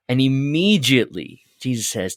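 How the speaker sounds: ordinary delivery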